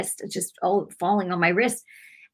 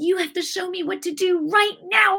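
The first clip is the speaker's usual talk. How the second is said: urgent plea; 11 semitones higher than usual. Retold or imitated. Retelling her cry to God.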